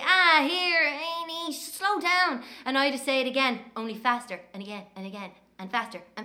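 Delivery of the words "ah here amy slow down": put-on higher, louder boyish complaint; a kid begging his sister to slow down; little brother's protest. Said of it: "And he'd say".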